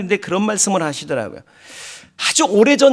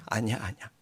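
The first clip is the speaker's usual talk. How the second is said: low, hushed retold reply; soft refusal; 8 semitones lower, 12 dB quieter than usual. Preacher voices father declining to get up, soft refusal. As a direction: drop the voice to gently refuse.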